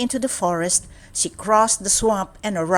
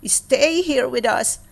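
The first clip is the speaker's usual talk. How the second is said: slow, gentle dwarf voice, a bit higher; kind dwarf; 5 semitones higher, slower than usual. A narrator's reading. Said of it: "one of them said".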